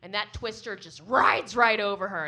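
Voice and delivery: ordinary delivery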